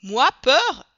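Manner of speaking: beaucoup plus fort et aigu, piqué au vif; vexé. A narrator's reading. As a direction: Passepartout offensé par le colonel. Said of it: "s'écria Passepartout". (exclamation indignée)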